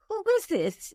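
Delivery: baffled. Retold or imitated. Retold puzzled phone call to sister.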